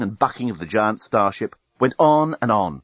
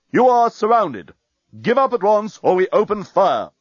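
loud, high, commanding announcement; booming; 8 semitones higher, 4 dB louder than usual. A narrator's reading. Enemy fleet ordering the starship to give up, booming. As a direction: deliver an ultimatum over a loudspeaker. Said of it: "A voice suddenly boomed over the ship's loudspeaker".

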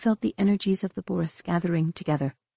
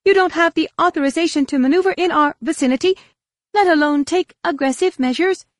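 high, loud, indignant objection; heated protest; 12 semitones higher, 6 dB louder than usual. A narrator's reading. Darek denying Luke authorization, heated protest. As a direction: object forcefully at a raised pitch. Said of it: "Darek protested heatedly".

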